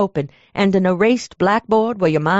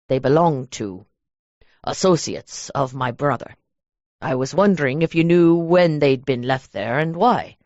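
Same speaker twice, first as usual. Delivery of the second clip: a lower, measured man's voice; lowered male voice; 4 semitones lower than usual. Acted. Derek introducing himself to the clerk, low and measured. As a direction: play the cold, polite stranger, low.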